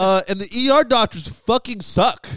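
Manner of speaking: loud, high, drawn-out mock rant; livestreamer impression. Patient ranting live about doctors, loud mock streamer voice. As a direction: imitate a streamer complaining to the audience. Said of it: "he goes live and he's like".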